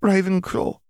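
high, startled cry of the name; a boy amazed he said it aloud; surprised blurt. A narrator's reading. Boy blurting his house choice to Riddle, startled and high. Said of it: "surprised that he had been able to say the word".

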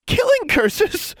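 very high, drawn-out squeal of horror; horrified squeal. A narrator's reading. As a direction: squeal the question slowly in horror. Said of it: "Anna squealed in horror".

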